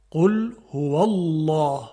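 قل هو الله slow, with the lam of الله thick; heavy lam. Shown as a demonstration. Teacher models the correct recitation slowly. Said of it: "مفخم است".

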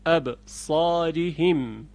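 ابصارهم slowly with open a; slow demo of ابصارهم; careful recitation. Shown as a demonstration. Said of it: "مانند ابصارهم".

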